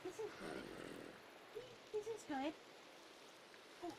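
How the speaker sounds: high soft cooing. Real, not acted. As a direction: coo to the pet softly. Cooing to pet.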